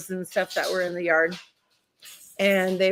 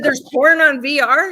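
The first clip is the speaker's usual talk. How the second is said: mock shocked "Porn?", louder and higher; shocked echo; 7 semitones higher, 8 dB louder than usual.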